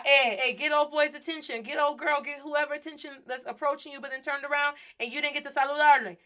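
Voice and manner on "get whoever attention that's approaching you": ordinary delivery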